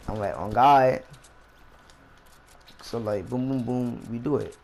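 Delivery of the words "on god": retold loud promise; loud oath. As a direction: bark it out as a sworn oath. Swearing agreement to friend.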